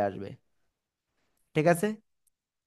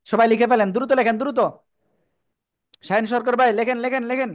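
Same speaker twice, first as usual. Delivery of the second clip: hurrying students; 7 semitones higher, 4 dB louder than usual. Real, not acted. Teacher hurrying students to write.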